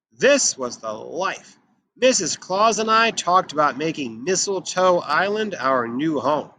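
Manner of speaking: ordinary delivery, nothing marked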